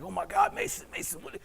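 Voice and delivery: high, hurried alarmed mock cry; panicked coach. Coach finds his athlete bleeding, imitated panic.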